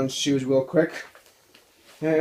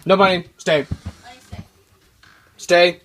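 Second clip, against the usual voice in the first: louder command to dogs; firm command; 6 dB louder than usual. Real, not acted. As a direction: tell the dogs to stay.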